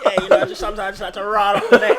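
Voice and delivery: fast, loud and laughing. Real, not acted. Guest cracking up at his own line, fast and laughing. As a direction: rattle it off while cracking up.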